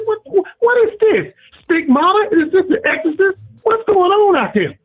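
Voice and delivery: high-pitched incredulous outburst, voice jumping up; retold outraged disbelief. Caller retelling his anger at the game, pitched high in disbelief. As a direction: relive the shock of the game, pitched well up. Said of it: "so upset".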